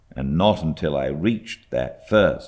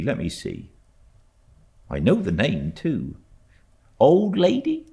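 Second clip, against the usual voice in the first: lightly voiced fly driver; 8 semitones higher than usual. A narrator's reading.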